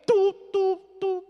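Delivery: high, loud monotone phone beeps; imitated dial tone. Son cut off by mother, mimicked disconnect tone. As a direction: mimic a hung-up line, beep by beep.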